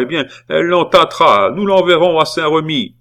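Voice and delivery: high, loud imperious pronouncement; commanding conviction. A narrator's reading. Aunt pronouncing the girl's convent fate, commanding. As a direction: declare the sentence with total authority. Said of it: "autorité et une conviction de sa puissance".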